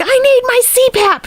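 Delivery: high-pitched imitation of a desperate demand; hammed-up, high-pitched whining demand; mock demanding wail. Streamer at the door demanding her machine, mock wail.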